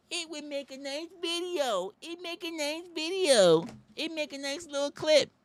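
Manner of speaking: high, sing-song mocking voice; mock clueless filmer. Comic mocks people filming bears, high and sing-song. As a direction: sneer it high like a silly influencer.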